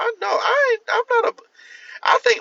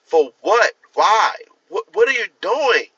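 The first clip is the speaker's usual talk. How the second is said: louder, higher, bewildered questioning; incredulous demand; 7 semitones higher, 4 dB louder than usual. Retold or imitated. Confronting someone on the street, loud incredulous questions. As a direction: demand answers from a stranger, rising in disbelief.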